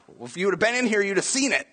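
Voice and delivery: high-pitched retort; mock exasperation. Acted retort to dad.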